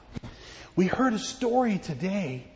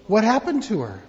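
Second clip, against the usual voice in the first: ordinary talk, nothing marked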